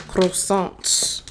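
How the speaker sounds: put-on "Croissant" said with a Spanish accent, not the French way. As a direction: say "Croissant" the way a Spanish speaker would. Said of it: "I definitely said it, like, with a Spanish accent".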